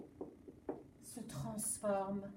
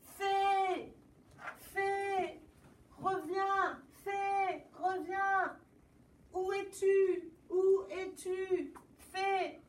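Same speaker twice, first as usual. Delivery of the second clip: the king shouts for the fairy, higher; king calling; 7 semitones higher, slower than usual. Acted. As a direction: call for her across the room. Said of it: "Le roi crie".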